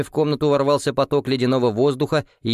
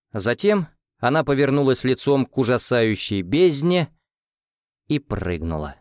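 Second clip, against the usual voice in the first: plain reading voice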